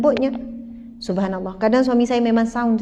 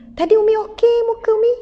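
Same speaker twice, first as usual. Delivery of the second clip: put-on higher, small child asking; 9 semitones higher than usual. Young son Imran asking his tired mother, childlike and higher. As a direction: play the little son, puzzled.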